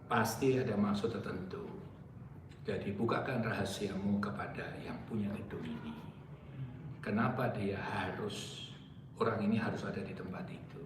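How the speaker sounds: soft, low whispered plea; hushed prayer to God. Retold or imitated. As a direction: pray under your breath. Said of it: "Saya hanya berdoa".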